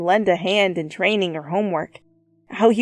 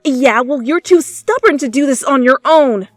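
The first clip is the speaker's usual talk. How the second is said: teasing jab, raised and loud; taunting; 5 semitones higher, 7 dB louder than usual. A narrator's reading. Teasing Bakugo back at the nurse's door, taunting. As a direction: poke fun at him. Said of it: "you taunted back".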